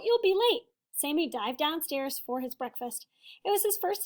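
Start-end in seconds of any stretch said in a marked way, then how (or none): 0.0-0.6 high, calling out to her son; mother calling. Acted. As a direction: call upstairs like a busy mum. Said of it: "his mother called".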